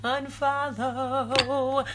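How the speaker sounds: a long, lilting unfollow, almost sung; drawn-out singsong. Shown as a demonstration. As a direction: draw unfollow out, high and teasing. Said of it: "click unfollow".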